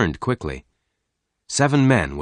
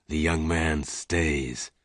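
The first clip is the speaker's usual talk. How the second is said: softer, slow, firm repeat; quiet firm repeat; 3 semitones lower, 6 dB quieter, slower than usual. A narrator's reading. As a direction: say it again, slow and unmoving. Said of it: "the stranger said again".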